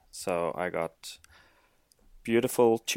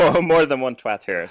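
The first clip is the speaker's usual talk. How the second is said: laughing; 5 semitones higher, 11 dB louder than usual. Real, not acted. Laughing at being called twat.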